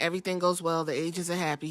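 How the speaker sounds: ordinary delivery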